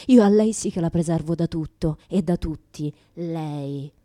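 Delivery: ordinary talk, nothing marked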